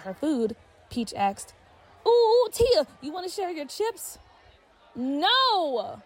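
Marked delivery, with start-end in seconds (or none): 2.1-4.2 put-on higher, breezy young girl voice; carefree Peach brushing off her friend, bubbly girl voice. A narrator's reading. Peach asking for chips. 4.9-6.0 sharp yelled refusal, higher and louder. Acted. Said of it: "Tia yelled".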